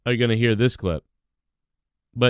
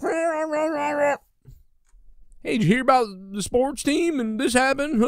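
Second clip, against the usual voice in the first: high, far-off mocking voice of an idiot caller; mock the clueless caller on the phone; hammed-up, high-pitched dope talking about sports; dumb phone caller; 13 semitones higher than usual. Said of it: "he's like".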